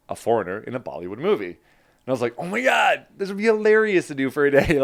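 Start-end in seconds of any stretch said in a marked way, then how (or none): 2.4-4.8 high, loud, gushing excitement; retold excitement, high-pitched and louder; excited outburst. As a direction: gush about a hilarious opportunity. Excited reaction to casting post.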